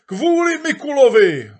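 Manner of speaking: very high, loud shout; crowd shouting. Acted. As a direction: bellow the answer as a crowd. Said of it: "odpověděla masa". (dav hlasitě odpovídá)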